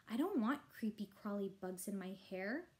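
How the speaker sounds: plain reading voice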